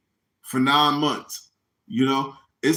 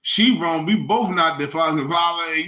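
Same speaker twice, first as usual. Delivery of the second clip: normal talking voice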